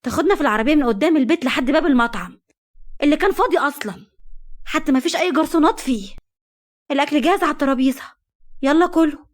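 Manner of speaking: wife mocks her husband's empty outing, sarcastic and heated; sarcastic irritation. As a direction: needle him with biting sarcasm. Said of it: "وقالت دهب بغيظ".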